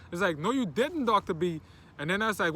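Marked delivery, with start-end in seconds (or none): 0.4-1.6 high, raised-voice contradiction; mock insistent denial; imitated student protest. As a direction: push back at the teacher, pitched up. Acted argument, student denying.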